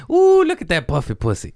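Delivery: loud, high teasing relative; family dinner voice. Retold or imitated. Aunt at Thanksgiving dinner, high and loud teasing.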